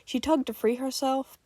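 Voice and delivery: plain reading voice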